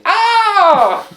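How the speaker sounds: very high, loud mock scream; piercingly high yelp, hammed up; comic scream. Comic scream after the bit.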